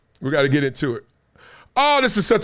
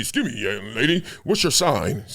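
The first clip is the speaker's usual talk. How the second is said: low, softer put-on suave approach; mock pickup line; 6 semitones lower, 4 dB quieter than usual. Pretending to approach a young lady, mock smooth. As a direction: drop the voice for a corny come-on.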